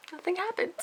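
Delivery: high, exasperated squeak; going crazy. Real, not acted. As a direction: let the frustration push your voice right up. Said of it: "I'm going crazy".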